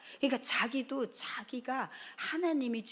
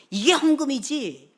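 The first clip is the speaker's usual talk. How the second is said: loud, stressed retelling of the son's words; emphatic recall; 5 semitones higher, 10 dB louder than usual. Retold or imitated. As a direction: hit "이게" hard. (크게 힘주어 전하듯)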